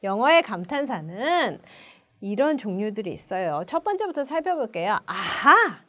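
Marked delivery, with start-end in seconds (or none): 5.1-5.8 sudden "now I get it" aha; realization. Shown as a demonstration. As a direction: let the second syllable pop louder. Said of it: "아, 나 알았어". (깨달은 듯한 감탄)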